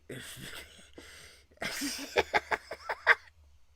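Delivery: high breathless wheezing laugh. Real, not acted. Reacting to scene, cracking up.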